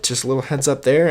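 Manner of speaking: usual speaking voice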